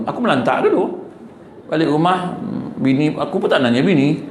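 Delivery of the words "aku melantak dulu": normal talking voice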